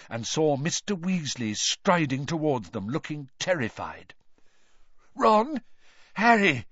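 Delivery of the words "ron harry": high, loud, anxious call; shaky voice. Acted. Terrified father striding towards the children, shaky voice. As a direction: call the names in shaken fear. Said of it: "His voice sounded shaky".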